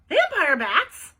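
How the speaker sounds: loud, high, drawn-out question; astonished. A narrator's reading. Bean hears Ivy's warning about blood, astonished. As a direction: blurt it out in loud disbelief.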